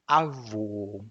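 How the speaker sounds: avô ending on a pure ô vowel; Portuguese avô. Shown as a demonstration. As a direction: land avô on one clean ô. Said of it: "the pronunciation".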